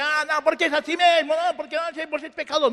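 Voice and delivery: half-voiced imitation of shouted preaching; mock yelling. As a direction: imitate someone hollering accusations at sinners. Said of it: "gritar mais alto".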